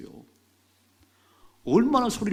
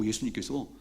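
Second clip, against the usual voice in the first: usual speaking voice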